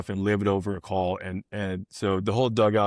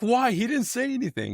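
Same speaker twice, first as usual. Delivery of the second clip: much higher, indignant protest; protesting Skip; 13 semitones higher than usual. Retold or imitated. Manager defending his coach to the umpire, high indignant protest. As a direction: defend the bench coach in outrage. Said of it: "He's like".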